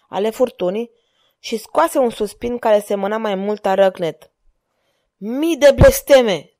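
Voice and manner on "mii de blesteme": loud, slow, higher roar of a curse; slow thundering oath. A narrator's reading. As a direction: bellow it out in fury. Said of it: "tună el".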